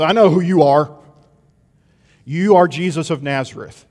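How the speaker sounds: loud, taunting demon naming Jesus. Acted. Demon confronts Jesus by name, loud and defiant. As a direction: hurl his name at him like a weapon. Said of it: "The demon says".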